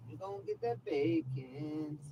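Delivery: pet talk. Acted. Teasing the cat with bacon.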